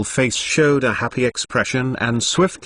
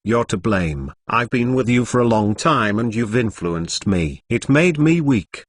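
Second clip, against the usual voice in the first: plain reading voice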